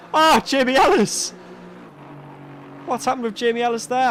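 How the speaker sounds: startled commentator outburst; surprised exclamation. Real, not acted. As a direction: gasp out the driver's name. Commentator sees sudden drop.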